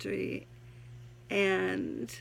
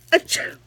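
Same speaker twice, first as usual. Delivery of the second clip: fake sneeze; 12 dB louder than usual. Sneeze gag inside poem.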